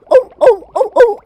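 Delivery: loud, high sea lion barks; amateur sea lion impersonation; sea lion noises. Pretend. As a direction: bark like a silly sea lion. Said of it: "how to do sea lion noises".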